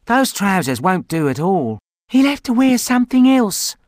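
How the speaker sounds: decide firmly; teen girl voice. Acted. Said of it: "said Kerry".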